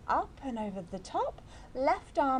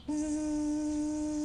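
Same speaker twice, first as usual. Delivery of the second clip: steady, higher-pitched sustained buzz; buzzing bee; 5 semitones higher, 3 dB quieter than usual. Shown as a demonstration. Teacher showing children the bee sound, buzzing. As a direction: hum a long buzz like a bee. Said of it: "make a sound like a buzzing bee".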